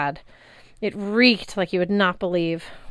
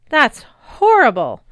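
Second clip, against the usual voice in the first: loud, slow, higher outraged cry; appalled; 6 semitones higher, 9 dB louder, slower than usual. A narrator's reading. Girl appalled by the rancher, loud outraged cry. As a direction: sound disgusted.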